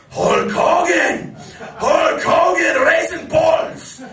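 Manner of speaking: slow, high, very loud ranting shout; furious Sheik shout. Retold or imitated. Imitating the Sheik raging at Hulk Hogan, slow high shout. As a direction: bellow each name like a furious old wrestler. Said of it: "And he goes".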